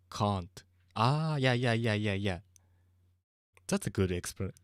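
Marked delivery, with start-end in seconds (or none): 0.1-0.6 "can't" with a long British "ah" vowel; British pronunciation. Shown as a demonstration. Giving a good example of accent difference, British "can't".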